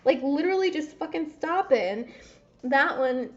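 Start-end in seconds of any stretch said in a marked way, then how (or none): 0.0-2.0 frustrated inner voice. Retold or imitated. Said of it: "part of me that's frustrated".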